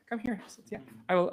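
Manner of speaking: normal talking voice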